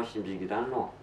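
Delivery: usual speaking voice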